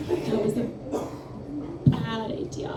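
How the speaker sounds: hushed, almost whispered warning; quiet aside. Retold or imitated. Friend Autumn warning her in class, hushed half-whisper. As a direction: lean in and breathe it like a secret. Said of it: "She looked at me and said".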